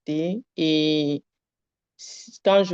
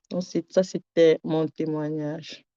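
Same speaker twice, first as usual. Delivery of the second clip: usual speaking voice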